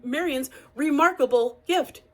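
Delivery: usual speaking voice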